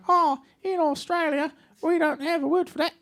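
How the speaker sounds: high-pitched mock Australian accent; Australian wife. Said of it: "And she'll be like".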